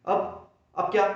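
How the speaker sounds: usual speaking voice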